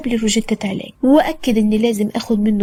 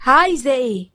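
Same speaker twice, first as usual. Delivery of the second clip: irritated; 3 semitones higher than usual. A narrator's reading. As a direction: answer the phone with annoyed impatience. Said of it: "وكلمني بعصبية غريبة".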